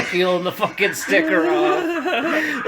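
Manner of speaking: loud, high, slow words through laughter; helpless laughter. Real, not acted. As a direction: struggle to get it out while laughing. Teller laughing at memory.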